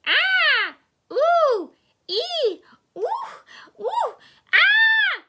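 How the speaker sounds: high, loud shrieks and whoops; mocking shrieks. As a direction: shriek like a tantrum in mockery. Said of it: "That's all you're gonna do?".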